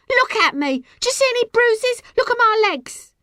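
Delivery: high, loud challenge to onlookers; defiant shriek; indignant yelling. A narrator's reading. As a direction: shout it to the whole crowd. Girl challenges the gathered crowd.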